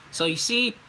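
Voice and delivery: ordinary talk, nothing marked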